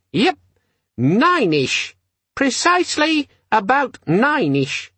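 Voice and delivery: high, slow little gadget voice; squeaky demon stating the time; squeaky, friendly. A narrator's reading. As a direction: chirp it like an irritatingly chummy gadget. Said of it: "squeaky, friendly quality that begs".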